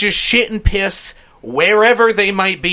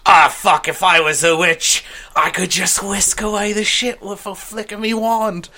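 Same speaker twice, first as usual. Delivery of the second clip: put-on slightly higher, half-hearted Cockney drunk; Cockney accent; 4 semitones higher than usual. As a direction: slur it out in a jokey Cockney accent. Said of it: "I don't know why I'm Cockney".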